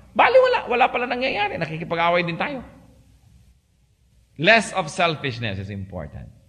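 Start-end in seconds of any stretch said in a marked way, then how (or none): 0.1-1.6 1 laughing exasperation. Real, not acted.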